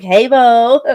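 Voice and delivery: mock American greeting; bad American accent. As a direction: throw out a cheesy American hello. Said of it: "like, like".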